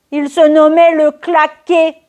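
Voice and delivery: high, slow intoned proclamation; mock-solemn reveal. Woman revealing the name was Le Claqué, mock-solemn. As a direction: draw it out on a high, level pitch.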